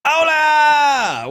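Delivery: big, high hola shout; shouted greeting. Real, not acted. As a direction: call it out across the room.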